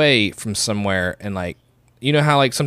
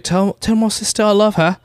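high imitated plea over the radio; mock radio distress call; pilot's radio plea; 11 semitones higher than usual. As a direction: voice the desperate pilot's message. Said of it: "on the radio she's like".

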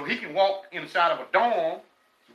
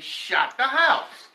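slow, drawn-out, higher voice; proud punk's answer; 6 semitones higher, 3 dB louder, much slower than usual. Retold or imitated. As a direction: stretch the name out proudly, slowly. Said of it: "He said".

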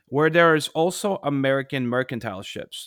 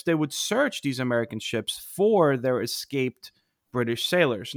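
ordinary talk, nothing marked

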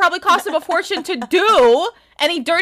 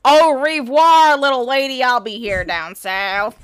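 put-on Southern accent. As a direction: say goodbye as a Southern cowgirl. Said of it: "I can do a pretty good one".

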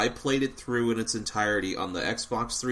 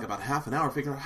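normal talking voice

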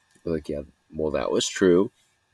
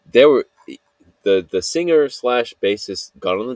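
excited; 5 semitones higher, 7 dB louder than usual. Real, not acted. Gushing about the band.

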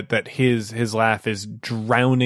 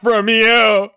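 loud, higher dopey brute; dopey cartoon bellow; 8 semitones higher, 6 dB louder, slower than usual. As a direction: bellow like a dim cartoon thug. GM voices bodyguard.

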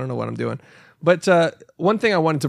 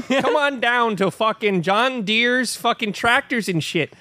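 higher-pitched local tractor pitchman; mock Minnesota ad; 6 semitones higher than usual. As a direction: sell it like a hammed-up regional commercial. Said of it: "I would get all these ads".

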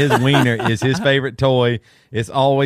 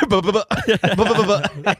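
higher, laughing sing-song babble; teasing babble chant; 7 semitones higher than usual. Retold or imitated. Kids mocking their mother, higher sing-song babble. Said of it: "They'll do that".

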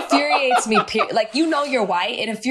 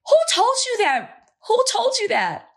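incredulous outrage; 11 semitones higher than usual. Real, not acted. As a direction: demand an answer in disbelief. Outraged at the candidate's claim.

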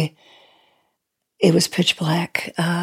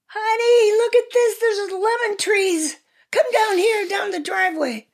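excited scream. Retold or imitated. House hunter calling partner to see the lemon trees, excited scream. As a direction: re-enact screaming out in delight, very high. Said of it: "I just screamed".

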